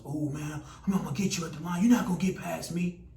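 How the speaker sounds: taunting defender, building to a high shout; rowdy on-field trash talk. Acted. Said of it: "Defense is talking noise".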